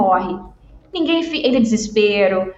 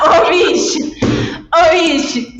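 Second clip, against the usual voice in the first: exasperated exclamation; 6 semitones higher, 4 dB louder, slower than usual. Real, not acted. Exasperated at client's request.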